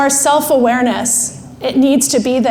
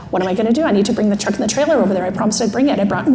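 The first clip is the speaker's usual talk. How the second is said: low, rushed fretting; fast spinning; 5 semitones lower, 3 dB quieter, much faster than usual. Acted. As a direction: babble worries without a breath. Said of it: "emotional brain does this really fast spinning".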